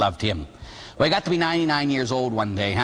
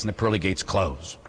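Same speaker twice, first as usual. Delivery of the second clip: ordinary delivery, nothing marked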